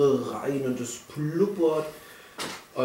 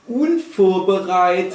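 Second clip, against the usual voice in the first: louder, higher, slow and proud; proud declaration; 7 semitones higher, 6 dB louder, slower than usual. Acted. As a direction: announce it like a motto. Proud podcast motto.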